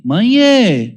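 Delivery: high, loud sweet "Mãe, é" asking nicely; child politely petitioning mother, sweet request; polite child's request. Retold or imitated. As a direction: say "Mãe, é" like a kid about to ask a favour. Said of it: "É diferente quando fala assim".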